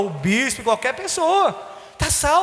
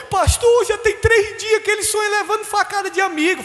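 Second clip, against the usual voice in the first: high pleading voice, lightly voiced; a hint of an anxious woman; worried sister; 10 semitones higher than usual. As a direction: plead to the pastor, voice raised high. Retold sister's plea, high voice.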